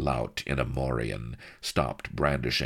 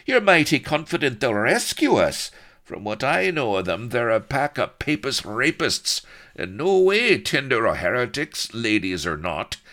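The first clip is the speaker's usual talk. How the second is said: put-on Irish accent; 9 semitones higher, 6 dB louder than usual. A narrator's reading. Blood voiced, Irish brogue.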